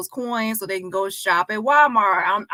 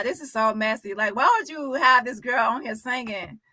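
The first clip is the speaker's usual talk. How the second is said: usual speaking voice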